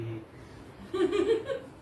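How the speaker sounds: high-pitched tittering laugh; playful high chuckle; high giggle. Acted. Husband laughs after explaining.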